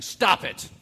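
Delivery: sudden loud barked command; mock scolding shout. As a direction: snap it out like a stern scold. Preacher voicing a scolding command.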